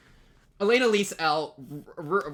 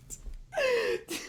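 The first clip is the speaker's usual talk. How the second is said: very high squeaky laugh of disbelief; incredulous laugh. Real, not acted. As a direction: crack up, unable to believe it.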